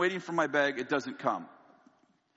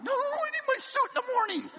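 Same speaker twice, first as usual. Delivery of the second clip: higher, fast frantic protest; faster than usual. Retold or imitated. Protesting on the phone to the airline, fast and frantic. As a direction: rush out the panic. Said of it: "She said".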